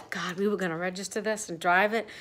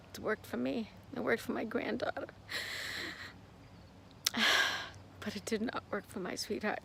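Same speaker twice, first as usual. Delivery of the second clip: quiet grief; 12 dB quieter than usual. Real, not acted. Grief about husband's death.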